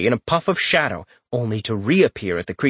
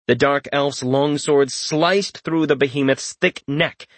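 usual speaking voice

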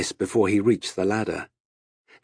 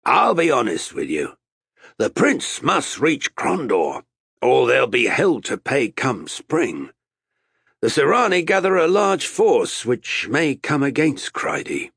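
raised, higher voice of a sea captain; captain addressing his crew; 6 semitones higher, 3 dB louder than usual. A narrator's reading. Captain reasoning with six recalcitrant sailors, projected address.

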